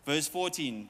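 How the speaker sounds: normal talking voice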